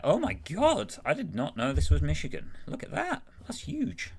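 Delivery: high-pitched real astonishment; amazed. Real, not acted. Seeing Michigan on the map, amazed. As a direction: gasp it out high, genuinely taken aback.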